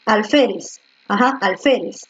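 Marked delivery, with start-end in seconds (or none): none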